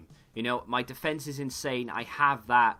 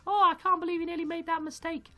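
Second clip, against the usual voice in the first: high, loud mock gasp of dismay; squealing mock alarm; mock horror; 12 semitones higher, 4 dB louder than usual. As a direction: act appalled at nearly making the mistake. Mock shock at own mistake.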